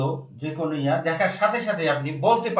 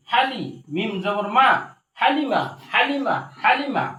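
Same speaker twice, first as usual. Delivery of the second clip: rhythmic Quran-class recitation drill; teacher modelling each word for repetition; spelling drill. Shown as a demonstration. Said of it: "একবার বানান তিনবার উচ্চারণ করে".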